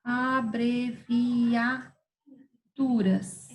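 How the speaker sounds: ABREVIATURAS very slow, each syllable held. Shown as a demonstration. Teacher naming the next topic to pupils, Abreviaturas slowly syllabified. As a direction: stretch Abreviaturas out as if dictating it. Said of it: "separação das palavras em sílabas".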